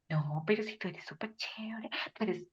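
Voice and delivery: coy breathy whisper, angling for compliments; fishing for praise. Retold or imitated. To a proud servant, hinting for compliments in a whisper. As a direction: whisper the flattery like bait.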